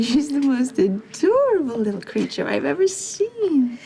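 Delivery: put-on high, slow cooing over a baby; baby talk.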